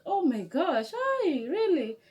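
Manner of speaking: high, slow, thrilled disbelief; overjoyed at finding a countryman; retold happy surprise; amazed delight. She reacts to meeting Kenyan.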